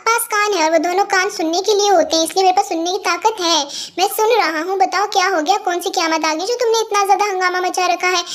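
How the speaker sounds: ordinary delivery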